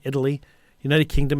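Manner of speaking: ordinary talk, nothing marked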